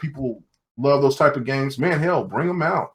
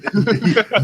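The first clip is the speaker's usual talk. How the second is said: higher-pitched real laugh; 6 semitones higher than usual. Real, not acted. Teasing a friend over the gameplay, laughing. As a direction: break up laughing mid-argument.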